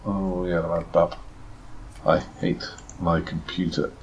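ordinary delivery

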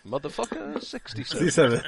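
bingo caller call with a laugh. Retold or imitated. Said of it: "what an amusing bingo caller you'd make".

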